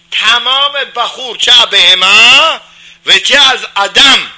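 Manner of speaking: loud, high, impassioned preaching with slow stretched phrases; fiery preaching. Acted. Rabbi to congregation on breaking inherited habits, fiery loud preaching. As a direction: thunder it out like a pulpit climax.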